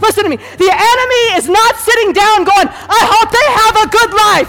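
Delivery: high, shouted pulpit fervor; full-force preaching. Real, not acted. Preacher warning congregation about the enemy, shouted full force. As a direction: cry it out with driving force.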